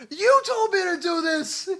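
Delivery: high, slow, loud wail; panicked protest. Acted. Game master as the man forced to shoot, desperate wailing protest. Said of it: "And he goes".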